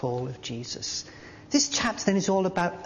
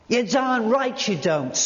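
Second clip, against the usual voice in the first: angry retort; 7 semitones higher, 3 dB louder, slower than usual. Acted. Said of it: "I found my anger increasing".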